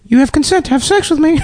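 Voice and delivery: mock parrot voice. Voicing the bully parrot.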